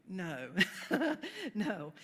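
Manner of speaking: amused laugh with the no; a real laugh at the idea. Real, not acted. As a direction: chuckle it off. Laughs at own question.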